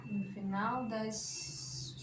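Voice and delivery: very slow, drawn-out dictation, soft. Shown as a demonstration. Teacher dictating the rule to the class, slow and soft. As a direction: dictate word by word, pausing for note-taking. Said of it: "dictating the fifth rule slowly".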